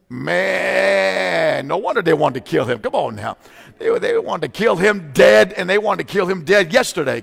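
fiery sermon shout about killing Jesus; preaching. Acted. Preaching at full force.

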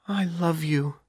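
high and soft, miserable. A narrator's reading. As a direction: voice small with misery. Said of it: "replied miserably".

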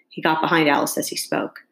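plain reading voice

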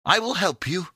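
high, loud, eager offer; crying out. A narrator's reading. Epimetheus runs to help Pandora, crying out. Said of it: "cried Epimetheus".

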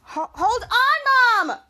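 loud, high, slow call with a strained, crying edge; real drawn-out shout at mom. Real, not acted. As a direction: stretch out the yell to mom. Calling out to mom again.

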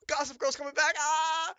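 mock gasp, then high, loud fangirl squeal; overjoyed squeal. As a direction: gasp and shriek it with giddy delight, acting it out. Acts out own overjoyed reaction.

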